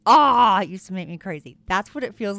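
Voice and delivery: ordinary delivery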